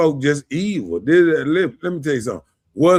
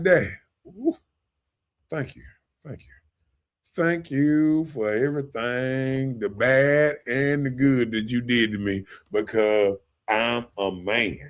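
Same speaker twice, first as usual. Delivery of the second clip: grateful thanks to dad; 4 semitones lower, 5 dB quieter than usual. Retold or imitated. Imagined thanks to father.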